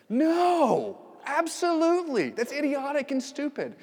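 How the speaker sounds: high, loud, indignant refusal; preacher voicing Paul's furious no; outraged shout. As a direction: yell it like the idea is insane.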